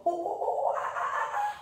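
high hooting ape calls; gorilla sound. Shown as a demonstration. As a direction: hoot like a gorilla. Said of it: "make your gorilla sound".

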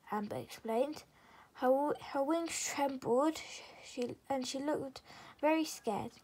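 plain reading voice

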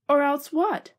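nervous retort. Acted. Flaversham facing Ratigan, anxious and high. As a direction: gulp and push back. Frightened prisoner answers.

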